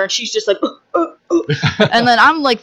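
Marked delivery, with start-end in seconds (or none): 0.6-1.9 high, drawn-out mock heaves; mimed retching. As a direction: imitate someone being sick. Imitating the extra vomiting.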